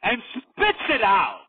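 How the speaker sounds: high, slow fight-night announcer; hyped-up announcer. Shown as a demonstration. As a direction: stretch it out like a ring announcer. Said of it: "let me hype it up".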